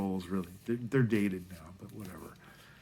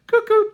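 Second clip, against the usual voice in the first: high, loud two-note cuckoo; mock cuckoo call; 17 dB louder than usual. Joking about writing sixteen hours straight, loud cuckoo call. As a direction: sing out a sudden cuckoo.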